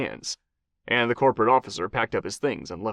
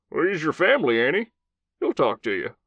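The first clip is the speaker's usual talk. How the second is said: gruff Southern commander, higher voice; old Southern cowboy voice; 5 semitones higher than usual. Acted. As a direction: play a blunt old cowboy soldier.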